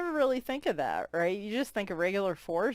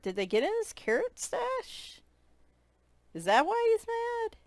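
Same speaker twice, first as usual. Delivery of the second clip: high playful voice; 13 semitones higher than usual. Acted. Playful guessing about gnome.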